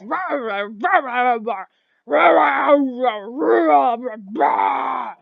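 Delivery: loud growled orc gibberish; rolling roars as an orc chief's talk; orc speech. Acted. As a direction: bellow in a made-up orc tongue. Game master voices orc chief's language.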